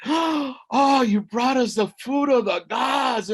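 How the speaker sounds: high, loud joyful exclamation; rejoicing. A narrator's reading. Elders receiving food of the gods, rejoicing. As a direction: exclaim in open delight. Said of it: "and they rejoice".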